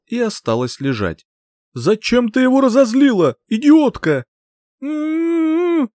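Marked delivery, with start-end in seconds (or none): 1.7-4.2 high, loud furious scolding; angry yell. Acted. Archmage yells at heroine. 4.8-5.9 muffled protest hum. Acted. Heroine with lips sealed.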